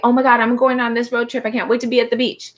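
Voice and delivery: mock an overeager traveller; giddy anxious excitement. Imitating someone rushing off on a road trip, giddy and hasty. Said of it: "But when you're anxious".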